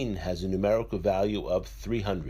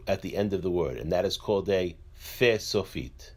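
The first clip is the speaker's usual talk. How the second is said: ordinary talk, nothing marked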